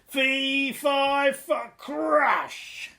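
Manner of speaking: high, loud cry into crash; falling giant's cry. A narrator's reading. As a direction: shout it as he falls.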